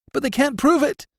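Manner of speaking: accused prisoner gasping his defence; gasped. A narrator's reading. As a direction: gasp it out in panic. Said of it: "gasped the prisoner".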